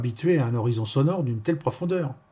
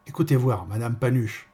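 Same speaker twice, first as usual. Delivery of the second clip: ordinary delivery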